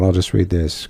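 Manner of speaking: ordinary delivery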